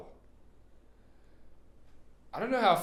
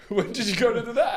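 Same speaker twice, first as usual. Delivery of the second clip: high, louder mocking echo; teasing mimicry; 11 semitones higher, 5 dB louder, faster than usual. Co-host mimics a garbled question, teasing. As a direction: parrot the question back through a laugh.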